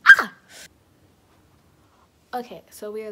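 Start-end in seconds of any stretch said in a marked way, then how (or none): 0.1-0.3 short high squeal of excitement; excited squeal. Real, not acted. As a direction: let out a burst of glee.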